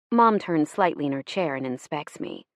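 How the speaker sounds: ordinary delivery, nothing marked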